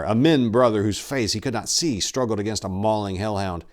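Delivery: ordinary delivery, nothing marked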